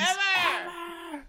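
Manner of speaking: very high theatrical shriek; mock scream. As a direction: cry it out in campy melodrama. Climax of acted tirade.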